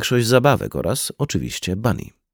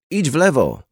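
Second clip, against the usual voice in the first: higher-pitched narrated call, little extra volume; restrained shout; 7 semitones higher than usual. A narrator's reading. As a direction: call out the direction with mild urgency. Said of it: "krzyknął Brian do Juliet".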